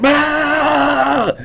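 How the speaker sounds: high put-on scream of terror; guards' scream. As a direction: shriek like a guard torn apart. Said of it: "They're all like".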